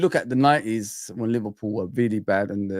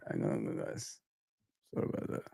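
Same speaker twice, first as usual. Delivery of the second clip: whispered apology; 16 dB quieter than usual. Real, not acted.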